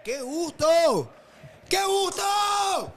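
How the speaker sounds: slow, high, loud delight; joyful shout. Real, not acted. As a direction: stretch out the joy. Co-host savours the goal.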